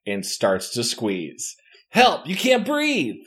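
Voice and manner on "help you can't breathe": high, strangled help; gasping call while being squeezed; choking cry for help. A narrator's reading. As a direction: narrator voices the choked player crying for help. Being choked by alien.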